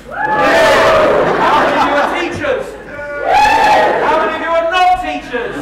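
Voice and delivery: loud, high shout to the crowd; rallying shout. Real, not acted. Speaker splitting the audience into camps, shouting. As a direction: whip the room into cheering.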